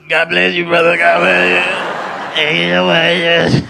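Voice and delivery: loud, high, long drawn-out cry; hammed-up wail. Comic playing his rattled self to rescuers, hammed-up wail. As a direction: wail it out, stretching the last words hugely. Acting out himself rattled after escape.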